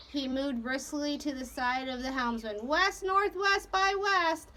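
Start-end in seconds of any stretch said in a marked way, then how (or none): 2.7-4.5 slow, high-pitched call; course order. A narrator's reading. Captain giving the helmsman a course, slow call. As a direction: call the heading out deliberately.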